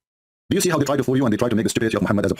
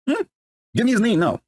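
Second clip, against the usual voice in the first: demanding; 10 semitones higher than usual. Retold or imitated. Mock demanding Musa.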